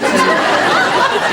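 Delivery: high burst of laughter; hearty high laugh; loud laugh. Real, not acted. Laughing at her own joke.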